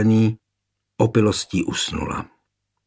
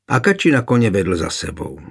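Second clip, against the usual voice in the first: ordinary delivery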